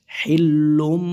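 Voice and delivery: হিল্লুম with a held double l; doubled lam. Shown as a demonstration. Teacher shows students the sound, হিল্লুম with doubled l. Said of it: "এইভাবে, হ্যাঁ।".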